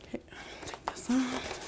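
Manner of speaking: unvoiced whispered aside. Real, not acted. Teacher whispering an aside to the helper. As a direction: breathe it off mic.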